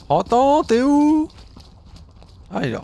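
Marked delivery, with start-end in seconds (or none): 0.0-1.3 loud high call across the field. Real, not acted. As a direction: shout the name to find him. Calling out to lost teammate.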